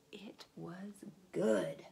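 soft whispered aside. Acted. Reader whispers to the children about the feast. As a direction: breathe it out.

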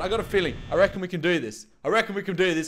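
ordinary delivery

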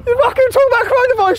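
very high, fast angry yell; real outraged shouting; furious outburst. Real, not acted. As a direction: explode at him in a rush. Outburst in the row.

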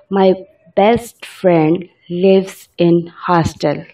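very slow, word by word dictation; slow example sentence. Shown as a demonstration. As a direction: read it out slowly for learners. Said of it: "example sentence".

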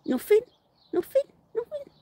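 tiny high-pitched pet voice; pet's innocent answer. Acted. Answering for the pet caught misbehaving, high innocent voice.

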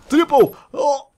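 high, loud triumphant yell; thrilled shout; excited shout. Real, not acted. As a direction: cheer the triple kill. Scoring a triple kill.

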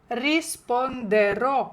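risponderò slow, with stress on the final -rò; risponderò drawn out, accent on the end. Shown as a demonstration. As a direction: lean on the last syllable of risponderò. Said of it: "А ну, кажіть мені".

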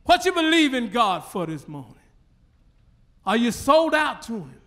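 normal talking voice

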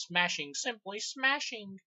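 high, slow mock leprechaun gloat, drawn out. Reviewer quoting the leprechaun's line, high and slow. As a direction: stretch "smashing" in a high, gleeful, lilting voice. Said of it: "The leprechaun goes".

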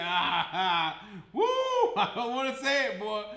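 laughing through the words; giggle, then balk at saying it; laughing reluctance. Retold or imitated. Imagined listener torn over the O J case, laughing and reluctant. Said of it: "you're like".

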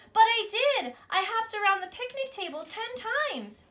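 insistent cry. Acted. Embarrassed girl insists to class.

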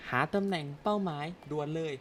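a lightly done heartthrob voice, hushed; handsome hero voice. Shown as a demonstration. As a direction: play the cool leading man reading his line. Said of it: "พากย์เสียงหล่อทำไม".

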